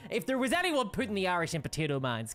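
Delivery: normal talking voice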